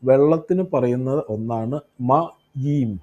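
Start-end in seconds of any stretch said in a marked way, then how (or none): 2.1-2.9 മയീം slowly, as ma-yeem; Hebrew pronunciation. Shown as a demonstration. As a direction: say മയീം with a long final eem. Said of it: "Hebrew word". (മയീം എന്ന് നീട്ടി പതുക്കെ ഉച്ചരിച്ച്)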